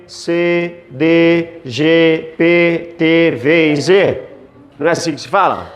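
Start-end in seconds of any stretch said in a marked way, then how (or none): none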